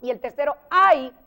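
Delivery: ordinary delivery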